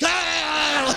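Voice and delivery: high hollered "ah"; mock shout. Trucker Jesus giving his only commandment, mock shout.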